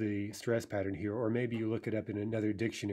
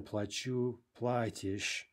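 плачу stressed on "чу", платишь on "пла"; end-stressed плачу, then stem-stressed платишь; shifting stress; much slower than usual. Shown as a demonstration. Teacher giving the key forms, плачу stressed last, платишь stressed first. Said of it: "that's shifting stress".

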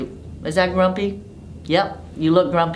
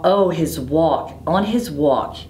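plain reading voice

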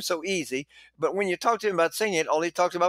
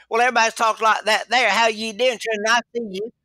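a light Texas drawl, higher and chatty; 4 semitones higher, 3 dB louder than usual. Retold or imitated. Teacher imitating how people talk back home, a light Texas drawl. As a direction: lightly put on a Texan twang. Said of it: "they talk like".

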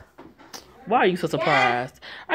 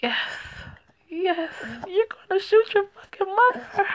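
disbelief; 13 semitones higher than usual. Real, not acted. Commentator reacts in disbelief.

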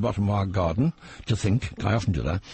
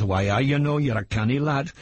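lightly put-on Geordie, higher and laughing; a playful Geordie lilt for a northern crowd; Geordie accent; 4 semitones higher than usual. Comic telling northern fans a Geordie joke, light Geordie accent. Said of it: "when I go up north".

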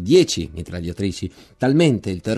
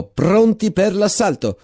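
higher, slow, commanding yell; captain's shout; 8 semitones higher, slower than usual. Acted. Captain orders men out of trench, shouted command. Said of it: "gridò".

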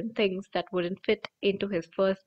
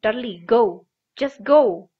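loud, frantic yell; 9 dB louder than usual. A narrator's reading. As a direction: bark the order out loud. Vernon yells at Dudley.

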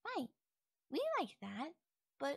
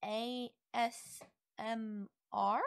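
usual speaking voice

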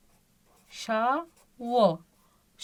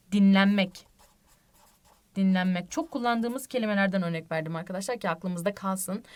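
normal talking voice

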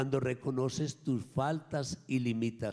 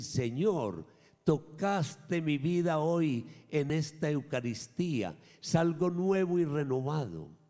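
joyful prayer; 6 semitones higher, 5 dB louder than usual. Pretend. Imagined prayer of thanks.

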